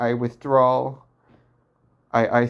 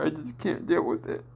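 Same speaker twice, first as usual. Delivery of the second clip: close to tears; 3 semitones higher, 5 dB quieter than usual. Real, not acted. Breaking down after dark confession.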